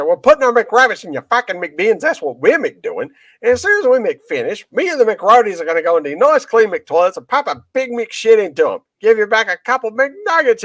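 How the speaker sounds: put-on high, ranting Australian accent. Comedian ranting at the McDonald's manager in an Australian accent. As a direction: rant at the manager in a broad Australian voice. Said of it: "good Australian accent".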